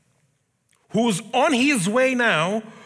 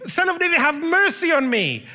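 blind man crying out; 8 semitones higher than usual. Retold or imitated. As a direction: call out over the crowd, begging to be heard. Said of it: "he's shouting".

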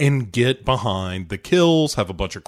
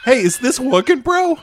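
mock shout; 13 semitones higher, 4 dB louder than usual. [name] shouting mid-song.

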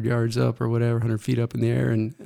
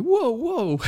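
high, startled whoa; mock alarm. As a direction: back off from a swinging sister. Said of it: "And I'm like".

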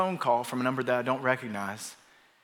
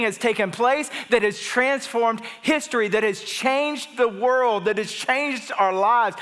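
high, ringing proclamation at full pulpit force; raised, driving sermon delivery with punched key words; impassioned preaching; 8 semitones higher, 4 dB louder than usual. Real, not acted. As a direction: declare it like news of a victory. Preacher builds to proclaiming the gospel.

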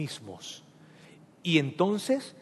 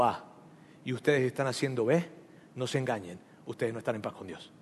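softer, earnest plea to the congregation, growing quieter; heartfelt and respectful; 4 semitones lower, 4 dB quieter than usual. Real, not acted. Pastor warns his congregation in a softer, sincere voice. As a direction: speak straight from the heart, gently but firmly. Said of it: "con todo mi corazón, y con muchísimo respeto".

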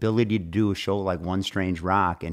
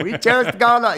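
put-on high, louder JFK Boston accent; 12 semitones higher, 5 dB louder than usual. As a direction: do your best Kennedy Boston voice. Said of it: "I can fake it a little bit better".

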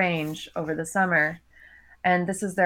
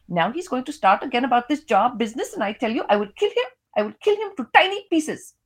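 put-on exasperated wife with an Indian accent, building higher; Indian mother's voice; 5 semitones higher than usual. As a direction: rant and threaten, rising at the end. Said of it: "I read in my characters' voices".

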